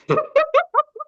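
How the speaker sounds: high-pitched burst of laughter; high giggle. Real, not acted. Reader flustered over a spicy scene, high giggle.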